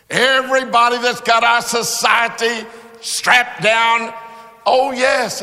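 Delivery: loud, rising sermon list; fiery preaching. Acted. Preacher condemning society to the congregation, fiery preaching. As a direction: hammer each group like a preacher.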